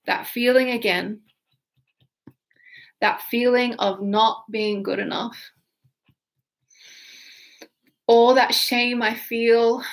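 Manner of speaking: slow, heavy voicing of a limiting belief; tapping script. Shown as a demonstration. Coach voicing a client's shame while tapping, slow and heavy. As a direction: give voice to the feeling as you tap.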